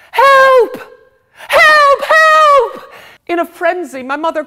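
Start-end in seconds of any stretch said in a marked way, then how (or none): 0.1-2.9 high, loud screams for help; family at the table, screaming for help in fright; terrified yelling. Acted. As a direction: shriek it in a frenzy of panic. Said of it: "Then all of us began to yell".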